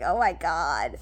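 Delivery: low, half-whispered dismay; hushed mock horror; dismayed aside. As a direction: breathe it out, appalled at yourself. Reaction to own puffy eyes.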